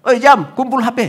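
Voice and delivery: high, loud call to hand over phones; wife calling time, loud and high. Retold or imitated. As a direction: shout from the next room.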